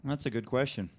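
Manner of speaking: talking through a mouthful; mouth full. Real, not acted. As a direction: answer around a bite of donut. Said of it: "with a mouthful of donut".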